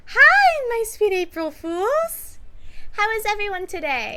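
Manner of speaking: high, loud concert greeting in a put-on voice; loud, high-pitched pop performer to fans; Rosie voice. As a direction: hail the crowd from the stage, high and drawn out. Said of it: "make it just the Rosie voice".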